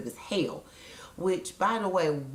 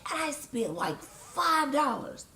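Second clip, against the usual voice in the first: much higher, slowed on five dollars; outraged disbelief; 11 semitones higher, slower than usual. Real, not acted. Complaining to viewers about the wasted money, incredulous. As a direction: stretch out the disbelief.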